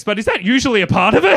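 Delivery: loud, high disbelieving question; comic disbelief, shouted; incredulous shout. Real, not acted. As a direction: yell the question at the room.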